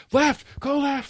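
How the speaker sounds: high-pitched imitation of a yelling coach; mock coach yell. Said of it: "they're screaming and yelling at the players".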